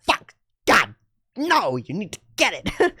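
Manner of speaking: acted frustration, pitch jumping high; exasperated outburst. Fan begging a friend to take the film seriously, exasperated. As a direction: plead in mock outrage, each curse on its own.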